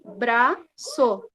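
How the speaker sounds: braço slowly split as BRA, pause, ÇO; syllable by syllable. Shown as a demonstration. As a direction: sound out braço in separate beats. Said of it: "três vezes também".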